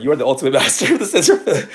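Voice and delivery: loud, giggling through the words; laughing while talking. Real, not acted. Replying to a viewer's comment, laughing loudly. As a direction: laugh as you tease the viewer back.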